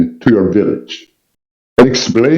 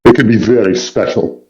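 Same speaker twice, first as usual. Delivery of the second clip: usual speaking voice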